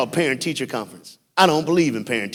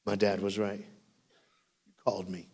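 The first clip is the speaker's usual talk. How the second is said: soft altar call; 3 semitones lower, 8 dB quieter than usual. Real, not acted.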